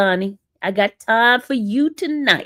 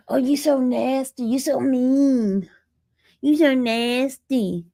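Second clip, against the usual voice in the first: lightly voiced mocking of viewers, slower; mock whiny complaint; 2 semitones higher, slower than usual. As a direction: whine it back at them slowly. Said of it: "Then y'all be like".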